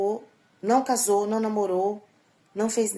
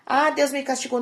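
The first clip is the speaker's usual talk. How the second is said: higher and louder mocking whine; mock whiny complaint; 6 semitones higher, 6 dB louder than usual. Mocking people who blame God, whiny and louder. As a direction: sneer at the self-pitying complainer.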